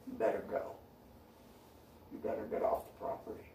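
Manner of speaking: whisper-low warning; quiet menacing get off the property; hushed threat. Retold or imitated. Renewed warning.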